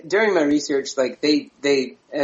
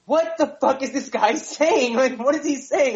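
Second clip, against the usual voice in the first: baffled laugh; 10 semitones higher than usual. Retold or imitated. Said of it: "I was like".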